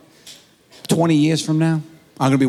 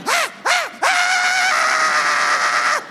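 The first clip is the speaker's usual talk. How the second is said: very high mock scream in short bursts, then held long; imitation of a kid losing it, shrieking; escalating high-pitched shrieks; child's panicked scream; much slower than usual. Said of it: "he loses his shit".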